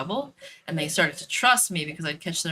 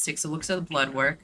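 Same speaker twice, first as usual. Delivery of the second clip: normal talking voice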